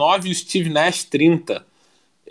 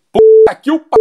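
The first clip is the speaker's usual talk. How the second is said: loud, high frustrated curse; exasperated outburst; 13 semitones higher, 10 dB louder than usual. Real, not acted. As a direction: blurt it out as the take fails. Breaks off on another flubbed take. (desabafo irritado e alto)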